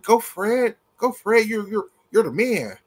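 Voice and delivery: high-pitched mocking cheerleading; sarcastic imitation of fans rooting him on; mock cheering fans. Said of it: "sitting up here like".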